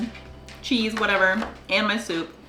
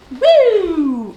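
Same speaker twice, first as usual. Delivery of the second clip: excited cheer; 8 semitones higher, 9 dB louder than usual. Real, not acted. Cheering for the last day.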